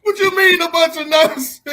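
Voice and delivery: high, loud mock-offended squawk; mock outrage. Real, not acted. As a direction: act scandalized, half laughing. Mock-offended laughing retort.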